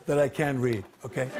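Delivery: ordinary delivery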